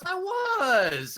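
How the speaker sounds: high-pitched imitated protest; mock protest. Imitating a friend insisting on innocence, mock protest.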